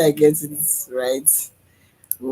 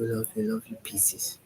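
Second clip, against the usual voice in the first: whisper; 2 semitones lower, 8 dB quieter than usual. Real, not acted. Repeated loving affirmation.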